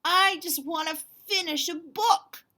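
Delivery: high, loud, eager dragon voice; young dragon whining; Duncan pleading. Acted. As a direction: beg it like an impatient little dragon. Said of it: "said Duncan".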